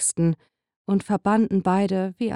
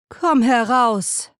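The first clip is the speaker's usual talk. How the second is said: slow, loud, commanding call; 4 semitones higher, 4 dB louder, slower than usual. A narrator's reading. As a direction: call her out, slow and sure. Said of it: "rief die Magierin".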